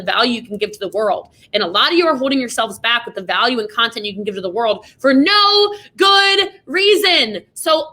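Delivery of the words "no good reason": loud, slow, drawn-out emphasis; shouting the point home; emphatic shout. Real, not acted. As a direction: lean hard into every word. Emphatic end of pep talk.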